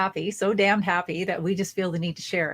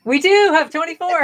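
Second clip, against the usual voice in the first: excited; 10 semitones higher, 9 dB louder than usual. Pretend. Joining the auction game.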